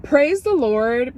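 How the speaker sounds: ordinary delivery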